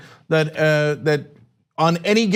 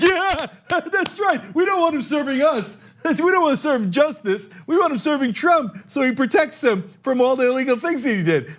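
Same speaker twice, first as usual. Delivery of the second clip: much higher, laughing mock crowd voice; mock cheering crowd; 6 semitones higher than usual. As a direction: gush along like a giddy fan. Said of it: "And everybody's like".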